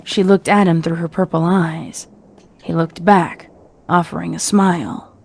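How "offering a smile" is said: plain reading voice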